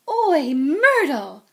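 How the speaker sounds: long, high, loud holler; bellow. A narrator's reading. Peeves calling the ghost Myrtle over, bellowing. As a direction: draw a big breath and bellow it out. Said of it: "took a deep breath and bellowed".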